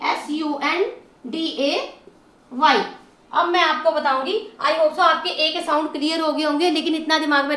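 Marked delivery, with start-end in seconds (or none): 0.1-2.8 slow spelling out. Shown as a demonstration. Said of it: "आपने लगाना है एवाई".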